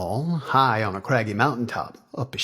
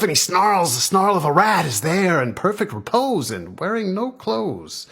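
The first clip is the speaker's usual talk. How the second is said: higher, driving verse narration; intense story narration; 7 semitones higher, 5 dB louder than usual. A narrator's reading. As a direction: build the ordeal line by line.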